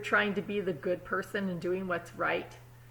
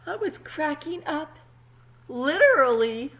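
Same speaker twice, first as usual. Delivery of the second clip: higher, loud overwhelmed egg; frazzled egg; 7 semitones higher, 7 dB louder than usual. Acted. Egg telling kids she is cracking, frantic. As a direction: play it at the breaking point.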